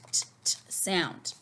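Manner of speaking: "t, t" whispered puffs; letter sound t. Shown as a demonstration. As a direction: breathe out short unvoiced "t". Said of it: "the T says t".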